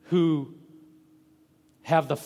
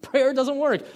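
"Prayer doesn't work" thrown out much higher and louder; exclaiming doubter; 10 semitones higher, 5 dB louder than usual. Retold or imitated. Preacher voicing a doubter protesting "Prayer doesn't work", high and loud. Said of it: "and say".

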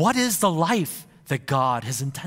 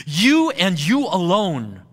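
both "you"s louder, slow and deliberate; stress on YOU; 3 semitones higher, 5 dB louder, slower than usual. Shown as a demonstration. Preacher recasting the verse for emphasis, stressing "you". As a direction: press each "you" hard and slowly. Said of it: "to really capture that emphasis".

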